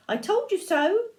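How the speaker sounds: high, piping sing-song; high-pitched storybook I-told-you-so; smug mouse. A narrator's reading. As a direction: gloat playfully as a little mouse. Mouse boasts to Gruffalo.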